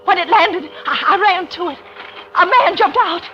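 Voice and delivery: low, hushed storytelling; story narration. A narrator's reading. Woman recounts the night of the killing, low and hushed. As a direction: tell it low and haunted, like a confession.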